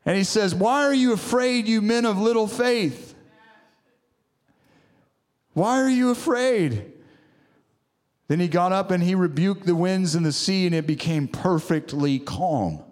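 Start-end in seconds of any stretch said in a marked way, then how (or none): 0.5-6.9 raised pitch, emphatic challenge; preached rebuke. Retold or imitated. Preacher quoting Jesus to frightened disciples, challenging. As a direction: deliver the quoted question as a pulpit challenge.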